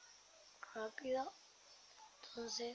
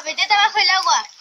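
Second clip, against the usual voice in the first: loud bossy; 5 semitones higher, 9 dB louder, slower than usual. Real, not acted. Bossing kids in pool.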